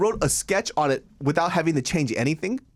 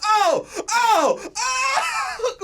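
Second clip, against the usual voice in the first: rising high "oh"s ending in a shout; a crowd gasping at a twist, climbing to a yell; shocked crowd; much slower than usual. Retold or imitated. Bystanders watching two women start yelling, shocked shout.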